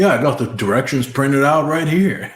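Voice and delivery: lightly voiced proud boomer; mock boomer. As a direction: play a proud clueless old man. Mocking a boomer with printouts.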